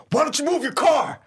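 high, loud imitation of an irate priest; comic impersonation of a shouted demand; mock angry yell. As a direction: yell it as if through a car window, hammed up. Imitates priest pounding on the window.

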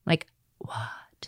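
whispered disbelief; soft whispered shock; stunned whisper. Retold or imitated. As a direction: breathe it out in shock. Stunned by the news.

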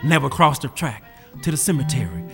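ordinary delivery, nothing marked

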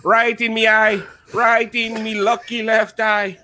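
hammy stage-Irish leprechaun; Lucky the Leprechaun. Acted. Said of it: "exclusive with Lucky the Leprechaun".

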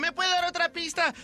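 high-pitched mock señora voice; caricatured caller asking for help; lady caller. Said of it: "dice la señora".

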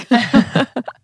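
high, loud burst of laughter; amused laugh. Real, not acted. Storyteller after the dog and Russian tale, laughing loudly.

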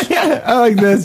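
high laughing voice; words through giggles. Real, not acted. Laughing while talking.

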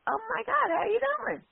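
high, gushing oh my God; mock gushing welcome; high excited greeting. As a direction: squeal the greeting brightly in a high voice. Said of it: "I'm like".

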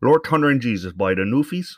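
mock Newfoundland accent, hearty; Newfie accent. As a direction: exclaim like a Newfie, East Coast accent. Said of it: "the Newfies with their East Coast accent".

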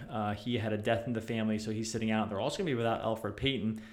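ordinary talk

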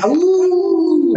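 high playful howl like a wolf pup; little wolf howl. Acted. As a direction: throw back your head and yip a short howl. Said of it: "I do a little howl".

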